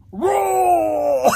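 loud, drawn-out action yell; battle shout. Acted. Launching into action, loud battle shout. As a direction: bellow the word long and loud.

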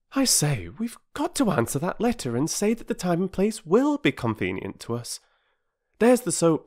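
put-on high, eager organising child; rally the others to the task as a keen child; brisk child. Voices a child in the story.

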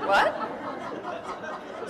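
breathy laughing what; amused laughing what. Real, not acted. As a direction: laugh it out in surprise. Laughing surprised question.

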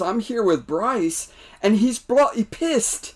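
high, amused English voice; a hint of a British mate; light British accent. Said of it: "not trying to do an accent".

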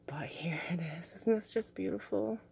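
soft breathy whisper with a laugh. Real, not acted. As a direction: whisper it in awe. Showing crystal close to camera.

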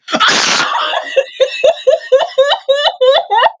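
high, breaking sobs; real sobbing. Real, not acted. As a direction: sob in a high wail. Overwhelmed, crying after episode ends.

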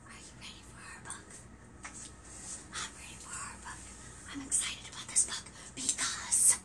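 hushed excited whisper. Acted. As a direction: whisper it like a secret. Whispering to build suspense.

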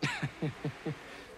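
soft chuckle of disbelief; dry chuckle. Acted. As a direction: chuckle quietly before challenging them. Reacts to unexplored options.

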